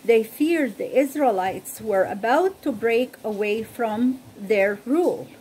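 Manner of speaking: clear, measured Bible story reading; storyteller voice reading scripture; story narration. Acted. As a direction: tell the story to listeners, evenly paced. Reads Bible story aloud.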